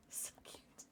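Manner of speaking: soft whispered coo. Real, not acted. Owner cooing at her sleeping dog, whispered. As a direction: whisper it so the dog stays asleep.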